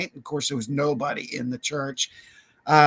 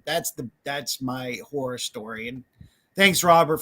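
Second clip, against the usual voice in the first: usual speaking voice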